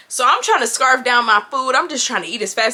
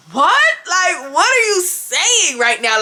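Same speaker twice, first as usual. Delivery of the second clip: incredulous; 5 semitones higher, slower than usual. Retold or imitated. Woman baffled by her date's therapy story, incredulous. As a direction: blurt it high, then stretch out the question.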